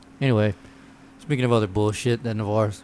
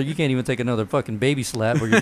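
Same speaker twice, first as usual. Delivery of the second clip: ordinary talk, nothing marked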